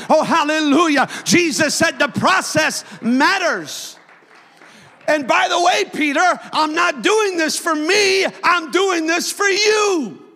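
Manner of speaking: loud pulpit-pounding sermon climax; preaching at full force. Acted. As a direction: build to a shouting climax with hallelujah.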